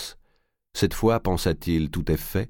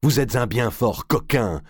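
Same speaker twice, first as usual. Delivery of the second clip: slow, higher-pitched outcry; mildly raised outraged voice; indignant exclamation; 6 semitones higher than usual. A narrator's reading. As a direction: cry out the accusation, drawing it out. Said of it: "s'écria Jean de Marigny".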